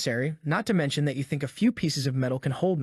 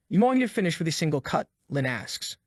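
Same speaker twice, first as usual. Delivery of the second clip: ordinary delivery, nothing marked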